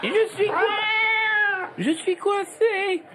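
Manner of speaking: high, slow cat voice; talking cat voice. Pretend. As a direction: play a stuck cat crying for help. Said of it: "Un chat radio". (voix de chat coincé dans l'arbre)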